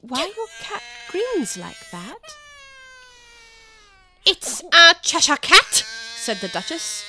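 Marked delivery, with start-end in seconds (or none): 0.0-2.2 timid. Acted. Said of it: "said Alice a little timidly". 4.2-5.8 loud, slow, haughty duchess; imperious plummy grande dame. Acted. Duchess voice held.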